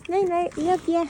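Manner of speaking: sing-song pet voice; cooing goodnight. Real, not acted. Goodnight to horses.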